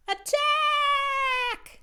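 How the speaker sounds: very high, very loud shout; battle cry. Acted. Owls charging the toy mouse, shouting very high and loud.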